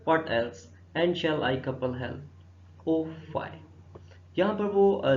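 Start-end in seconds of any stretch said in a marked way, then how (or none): 0.1-3.5 loud opening, slowing into measured verse; declaimed verse reading. Acted. Teacher reading Hamlet's soliloquy to students, slow declamation. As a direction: declaim it slowly, word by word.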